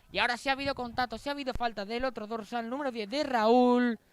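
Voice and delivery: excited play-by-play. Real, not acted. Foul called, rising excitement.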